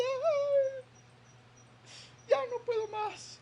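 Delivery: high, whining cry of giving up; mock despair. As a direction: sigh out that you can't go on. Believer voiced in despair.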